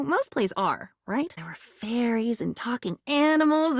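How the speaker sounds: normal talking voice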